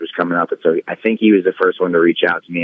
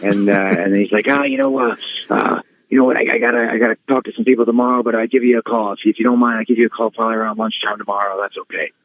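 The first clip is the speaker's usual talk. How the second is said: Laurinaitis impression; 3 semitones higher than usual. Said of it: "and he goes".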